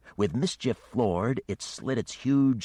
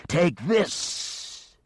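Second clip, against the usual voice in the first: much higher, louder beast taunt; attacking dragon; 11 semitones higher, 5 dB louder than usual. Acted. The dragon spews its stomachs at Geneva with a taunt. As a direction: hurl it like a monster striking.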